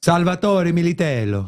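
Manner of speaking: put-on smooth Italian accent on the name. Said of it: "at least someone appreciated my Italian".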